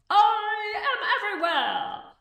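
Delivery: high, echoing magic voice; booming great head. Acted. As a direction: loom over them, everywhere at once.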